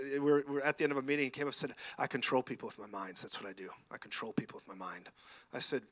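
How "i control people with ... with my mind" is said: quiet, low voice of a self-styled mind controller; low menacing claim. Retold or imitated. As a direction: keep it hushed and low, as if asserting power. Acting out man claiming mind control.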